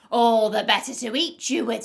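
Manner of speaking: very loud, disguise dropped; sudden roaring storybook threat; menacing wolf. A narrator's reading. Wolf drops disguise and pounces.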